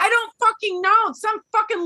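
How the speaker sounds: high, loud exasperated burst; angry outburst. Real, not acted. Fan answering a friend's question, angry. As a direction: spit it out in real anger. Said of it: "I'm so angry about it".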